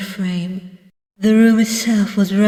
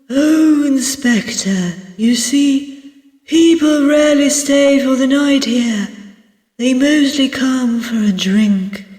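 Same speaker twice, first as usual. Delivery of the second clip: higher-pitched innkeeper character voice; hesitant innkeeper; 5 semitones higher than usual. Acted. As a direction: explain to a guest in a raised host voice. Voices innkeeper Barrow in a story.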